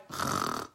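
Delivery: a breathy sigh of dozing off; mock sleepy sigh. Host to viewers, acting out falling asleep with a sigh. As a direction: sigh out as if nodding off. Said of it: "all of a sudden I'd be like".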